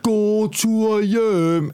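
high, very drawn-out jeering call; retold sing-out shout, long vowels; mock heckler call. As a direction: stretch each word out like a heckler. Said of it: "Som råber".

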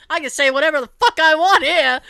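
laughing while shouting it. Retold or imitated. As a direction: crack up mid-line. Laughing through retold line.

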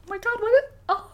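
high squealing laughing gasp; delighted surprise. Real, not acted. Surprised by gift bracelet.

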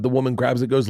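ordinary talk, nothing marked

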